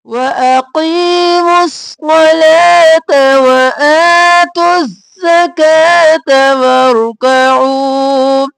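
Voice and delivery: slow, drawn-out melodic recitation; Quran recitation. Shown as a demonstration. Teacher recites the line for students, slow and melodic. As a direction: chant it slowly and evenly.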